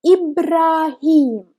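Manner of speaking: Ibrahim very slowly, every sound clear; slow model. Shown as a demonstration. Teacher modelling Ibrahim for the class. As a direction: draw out Ibrahim syllable by syllable. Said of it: "we pronounce it like E".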